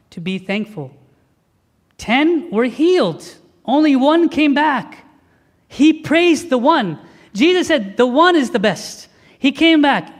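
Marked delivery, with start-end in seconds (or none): 2.0-10.1 slow, louder and higher, pressing each point home; preaching at full force. Acted. Preacher telling the congregation about the thankful one, slow and loud.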